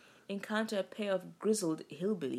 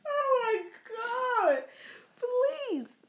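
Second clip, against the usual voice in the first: laughing; 7 dB louder, much slower than usual. Real, not acted. Reacting to the impression.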